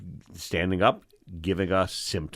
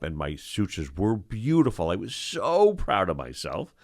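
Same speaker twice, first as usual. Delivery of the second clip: normal talking voice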